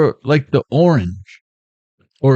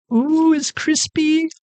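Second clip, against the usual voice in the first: much higher, slow pleased surprise; delighted ooh; slower than usual. Real, not acted. Host biting a fresh cookie, high and pleased. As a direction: savour the crunch in delight.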